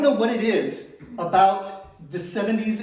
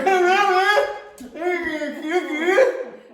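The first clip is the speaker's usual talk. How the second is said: delighted cackle; 10 semitones higher than usual. Retold or imitated. Savoring the soap.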